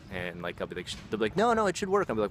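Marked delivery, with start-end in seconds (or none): 1.4-2.1 high-pitched mock protest; imitated insistence. Store clerk insisting the hardware works, mock-insistent.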